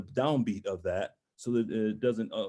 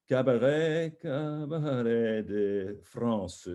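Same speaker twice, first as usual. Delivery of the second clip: playful lingering; much slower than usual. Shown as a demonstration. Coach shows singer the phrase ending, playful and lingering. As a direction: draw out the French words with relish. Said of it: "you can play with a little bit".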